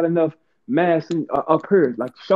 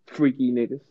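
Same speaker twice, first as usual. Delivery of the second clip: ordinary delivery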